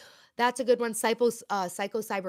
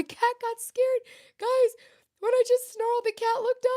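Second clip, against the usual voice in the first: high squeaky laughing voice; 8 dB louder than usual. Real, not acted. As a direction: giggle through the words. Laughing about cat fleeing snarl.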